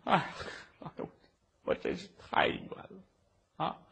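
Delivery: whispered lament of a beaten man. Acted. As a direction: breathe it out, too weak to speak.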